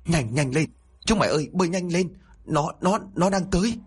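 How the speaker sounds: high, rushed and stammering in terror; panicked shout. A narrator's reading. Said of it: "hét lên trong sự hoảng loạn". (hét lên hoảng loạn, giọng cao và lắp bắp)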